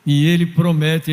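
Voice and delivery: normal talking voice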